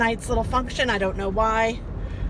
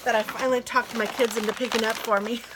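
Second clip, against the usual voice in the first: ordinary talk, nothing marked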